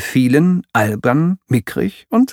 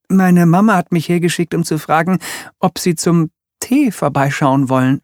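lightly voiced polite boy, higher; young boy Wilf; 7 semitones higher than usual. Wilf apologising for his sister, lightly higher boyish voice. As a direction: apologise like a well-mannered little boy.

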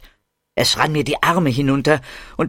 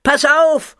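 high, loud alarmed yell; warning shout; 5 dB louder than usual. Acted. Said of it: "schrie ich". (Ich schreie Muki eine Warnung zu, laut und hoch)

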